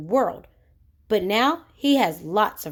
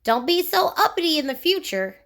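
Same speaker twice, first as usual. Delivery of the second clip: higher goblin voice, stern; a lightly voiced goblin scolding; warning goblin; 7 semitones higher than usual. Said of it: "warned the goblin".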